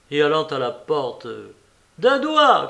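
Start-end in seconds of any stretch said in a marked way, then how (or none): 2.0-2.7 loud, high call to the servant. A narrator's reading. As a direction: call across to the door. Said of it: "cria-t-elle". (appel crié vers la porte)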